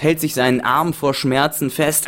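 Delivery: normal talking voice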